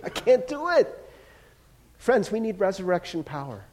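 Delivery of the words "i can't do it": laughing. Real, not acted. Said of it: "laughing helplessly".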